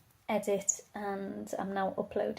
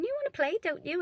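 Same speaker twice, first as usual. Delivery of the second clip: put-on much higher sing-song baby talk; 11 semitones higher than usual. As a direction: coo it at a toddler. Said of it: "I was going".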